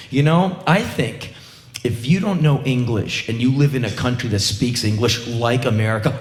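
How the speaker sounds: normal talking voice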